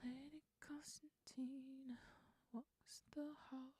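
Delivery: breathy whisper of the line; whispered lyric try. Real, not acted. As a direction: whisper it to test the rhythm. Whispering draft lyric lines.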